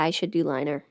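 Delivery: normal talking voice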